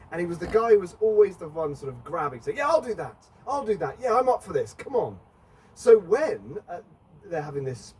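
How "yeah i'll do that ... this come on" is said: high, keen, hand-up eagerness; eager volunteering. Retold or imitated. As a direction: jump in like the first volunteer.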